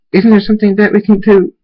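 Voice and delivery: high, loud frantic question; desperate. Acted. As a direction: plead for any way to help. Desperate for a way to save him.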